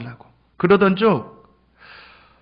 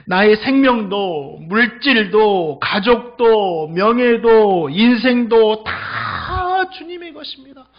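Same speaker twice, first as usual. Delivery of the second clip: slow, drawn-out preaching cadence; impassioned declaration, each word stretched; fervent confession; 3 semitones higher, 4 dB louder, much slower than usual. Acted. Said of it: "고백하는 거예요".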